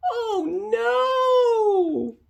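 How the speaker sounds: very high wailing oh no; long high wail; dismayed cry. Acted. Dismay at escape.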